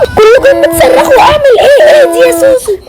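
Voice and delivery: high, very loud outburst; exasperated shouting. Acted. Overwhelmed by everyone screaming, asking Soso for help, exasperated shout. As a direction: shout over the screaming, voice pitched right up.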